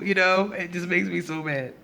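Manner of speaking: ordinary delivery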